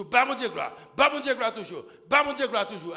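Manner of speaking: loud, high repeated glory shout; praise cry. Acted. Praising God as the baby's head appears, shouting. Voicing praise during birth.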